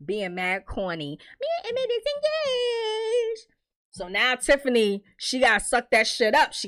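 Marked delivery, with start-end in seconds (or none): 1.4-3.5 high-pitched mock happy squeal; singsong gushing, far above normal voice; Keisha. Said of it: "Keisha is so happy".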